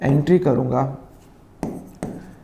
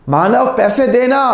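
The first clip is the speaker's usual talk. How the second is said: louder, higher call demanding the money; 7 semitones higher, 5 dB louder than usual. Retold or imitated. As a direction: call his name out, insisting on payment. Demanding money at Manav's office.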